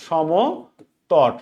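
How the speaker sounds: Samatata dragged slowly so students can write it; drawn-out dictation. Shown as a demonstration. Teacher to live students, spelling out a name very slowly. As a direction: stretch Samatata out very slowly, syllable by syllable.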